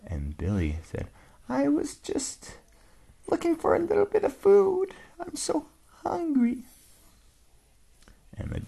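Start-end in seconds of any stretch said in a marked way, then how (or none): 1.5-6.6 high, tiny mouse voice; little voice. Acted. Mouse answering the old dog, tiny and high. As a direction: squeak it out small.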